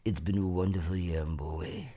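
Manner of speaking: put-on low, husky drawl with boy dropping way down; Kathleen Turner impression. Imitating Kathleen Turner flubbing Broadway at the Tonys. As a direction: do her slurry Tony Awards line. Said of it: "exactly what she said and what she sounded like".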